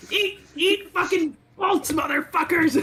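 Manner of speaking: high, slow taunting war cry; battle cry. Acted. Player to attacking orcs, high defiant battle cry. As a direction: shout it at the enemy, drawn out.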